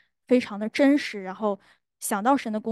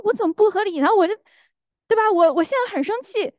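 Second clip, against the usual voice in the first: higher, louder defensive protest; indignant retort; 10 semitones higher, 6 dB louder than usual. Retold or imitated. Wife arguing back with the Holy Spirit, higher and louder. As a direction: push back hard at the question. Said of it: "我说".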